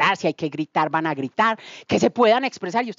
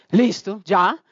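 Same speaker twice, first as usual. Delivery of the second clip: usual speaking voice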